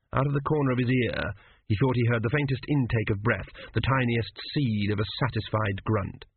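plain reading voice

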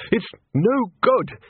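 high, slow, breathless-style splutter. A narrator's reading. Arch-Chancellor surfaces from a dive, spluttering report. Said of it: "he spluttered".

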